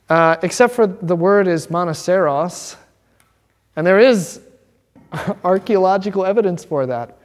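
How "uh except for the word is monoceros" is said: ordinary talk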